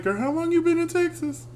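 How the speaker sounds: high-pitched mock-incredulous query; retold disbelief, voice climbing very high; incredulous question. Friend teasing a Valley girl about years in Texas, mock-incredulous.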